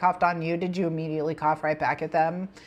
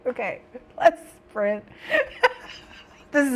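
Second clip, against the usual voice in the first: laughing; 4 semitones higher than usual. Real, not acted. Breaks up laughing at herself.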